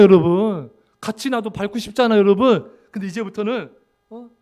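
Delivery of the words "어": ordinary talk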